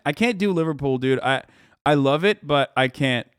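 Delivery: ordinary talk, nothing marked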